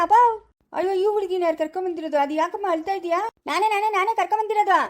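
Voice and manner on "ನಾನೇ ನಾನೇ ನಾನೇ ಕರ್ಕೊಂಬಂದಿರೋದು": indignant shout. Acted. To the old man, a heated shout that he brought her. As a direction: insist hotly that it was you. Quarrel over who brought girl.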